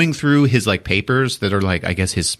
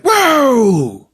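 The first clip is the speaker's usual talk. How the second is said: mock whoa; 14 semitones higher, 4 dB louder than usual. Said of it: "And they're like".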